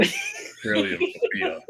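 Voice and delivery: laughing through a hammed-up Australian accent; laughing mock Australian accent; bad Australian accent. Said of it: "I have a terrible accent".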